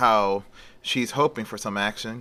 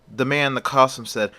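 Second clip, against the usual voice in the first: plain reading voice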